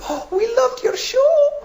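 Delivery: very high, drawn-out gush; hammed-up adoring fan. Woman praising the comic's show, high gushing voice.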